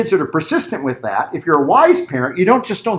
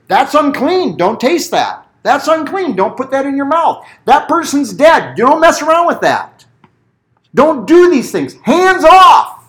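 高声响亮地严厉下禁令; 像律法一样威严地喝止; stern law commands; 10 semitones higher, 5 dB louder than usual. Retold or imitated. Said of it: "And what did the law say?".

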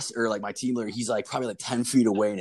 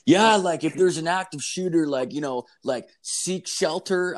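high, singsong mimic of the safety trainer; mocking the instructor's advice; 7 semitones higher than usual. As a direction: parrot the trainer in a silly high voice.

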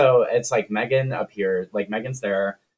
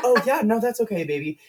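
high, cooing reassurance; sweet and pitched up, smoothing things over; soothing director; 7 semitones higher, faster than usual. Retold or imitated. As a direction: imitate a perky adult placating a small child. Director soothing kid, pairing up children.

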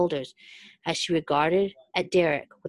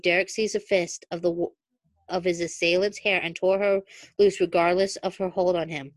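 usual speaking voice

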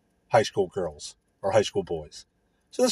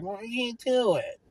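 put-on whiny old lady; 11 semitones higher than usual. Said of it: "these older ladies are like".

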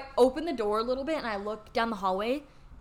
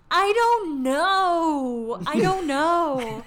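very slow, high, loud wail; imitated dazed friend; 5 semitones higher, 5 dB louder, much slower than usual. Friend asked why she went downstairs, mock wailing. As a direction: stretch it out in a silly put-on whine.

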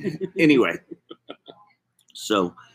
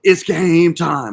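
slow, much higher and louder game show call; hype announcer; 10 semitones higher, 10 dB louder, much slower than usual. Acted. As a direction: boom it out like a host starting the show.

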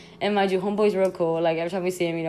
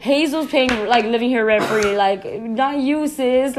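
higher and louder, sassy mock telling-off; mock scolding; 5 semitones higher, 5 dB louder than usual. Scolding the possum that drank their water, sassy mock rant. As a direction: tell the possum off, half laughing.

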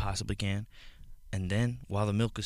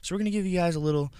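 high, bouncy host voice; sing-song announcer voice; exaggerated cartoon intonation; 6 semitones higher than usual. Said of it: "Why are you talking like that?".

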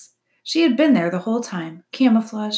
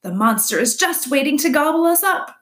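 high, loud, panicky shriek; 8 semitones higher, 6 dB louder than usual. A narrator's reading. Groundhog warns others of being eaten, shrieking. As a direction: build to an alarmed shriek. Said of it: "shrieked Dig Down".

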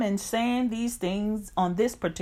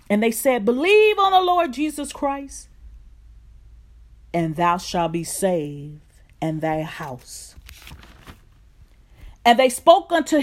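fervent rising scripture reading, louder; preaching scripture; 3 semitones higher, 5 dB louder than usual. Acted. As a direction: read the passage like a preacher building fire. Scripture read with rising force.

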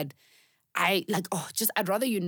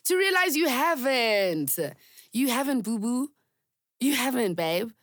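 higher, slow, teasing put-down; sassy self-reminder; 7 semitones higher, 4 dB louder, slower than usual. Real, not acted. Talking to her past self, higher teasing tone. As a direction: break the news to yourself, boo-boo. Addressing herself after failed hope.

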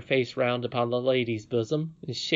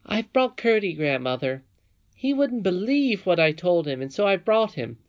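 put-on high, louder little-girl voice; eager princess explaining herself, pitched up; young princess, eager; 6 semitones higher, 4 dB louder than usual. A narrator's reading. Princess presents Curdie to her grandmother, high and eager.

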